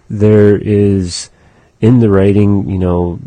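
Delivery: normal talking voice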